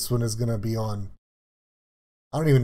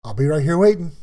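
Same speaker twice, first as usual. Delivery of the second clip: a lighter hint of dad, a little louder; imitating dad; 5 semitones higher, 6 dB louder than usual. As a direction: fondly echo dad's words in his voice. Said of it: "He goes".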